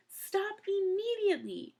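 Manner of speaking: high, slow command shouted; ordering. A narrator's reading. Mister Poe ordering through the gate, high slow command. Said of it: "Mister Poe ordered".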